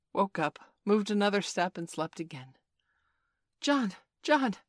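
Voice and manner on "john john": high, loud calling; child's cry. A narrator's reading. Michael waking his sleepy brother John, crying out. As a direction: call out to rouse a brother. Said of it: "Michael would cry".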